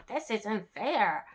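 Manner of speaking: slow, lightly voiced protest; mildly hammed-up indignant frog. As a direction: draw out a mock complaint. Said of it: "the frog's, like, indignant".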